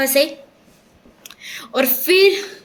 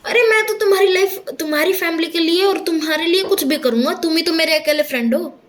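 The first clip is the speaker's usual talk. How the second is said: ordinary talk, nothing marked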